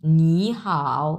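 "নি হাও" very slow, rising "নি" then low "হাও"; teacher's slow model of "নি হাও"; tone change demo. Shown as a demonstration. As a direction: stretch "নি হাও" in second plus third tone. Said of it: "উচ্চারণ তখন এইভাবে হয়ে যাবে".

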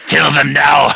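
shouted commanding emperor; mock Emperor command. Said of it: "I read them in the Emperor's voice".

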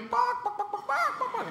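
high barked words like a small dog; yappy dog barking. Pretend. Barking like a dog to answer the man. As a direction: bark back like a dog.

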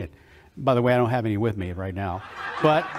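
laughing aside. Real, not acted. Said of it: "laughing aside to audience".

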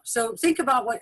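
ordinary talk, nothing marked

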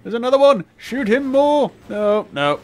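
shrieking high and loud; panicking gamer. Real, not acted. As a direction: scream it in full panic. Panicked shouting during firefight.

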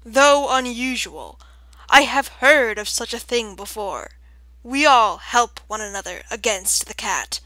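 loud, measured owl voice; sonorous owl. A narrator's reading. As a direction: pronounce it like a grave old authority.

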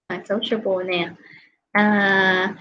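ordinary talk

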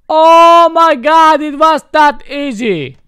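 loud, high-pitched disbelief; high, loud burst of surprise; amazed realization. Real, not acted. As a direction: cry out high in sudden astonishment. Suddenly finds the landing control works.

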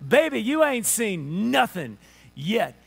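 slow, emphatic Alabama drawl; Southern drawl. Shown as a demonstration. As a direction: draw it out in a Southern accent. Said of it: "that's how we say it in Alabama".

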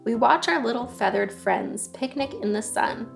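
ordinary delivery

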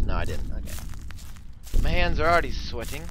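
ordinary talk

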